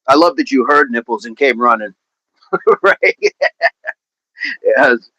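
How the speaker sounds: normal talking voice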